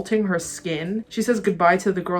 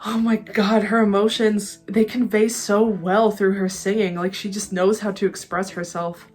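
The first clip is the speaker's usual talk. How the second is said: usual speaking voice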